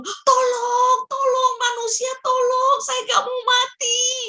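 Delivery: high-pitched tiny ant pleading; acted high squeal of a desperate ant; ant screaming for help. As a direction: cry out as a little ant about to be squashed. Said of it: "Semut itu teriak-teriak gitu".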